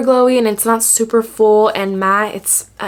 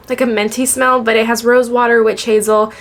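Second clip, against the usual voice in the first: normal talking voice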